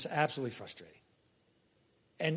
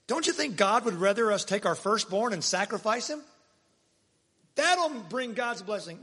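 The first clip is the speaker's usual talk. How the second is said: raised, higher, slowed sarcastic proposal; mock suggestion; 6 semitones higher, 6 dB louder than usual. Preacher mocking a wrong idea of God to the congregation, sarcastic and raised. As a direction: dangle the absurd idea with sarcasm.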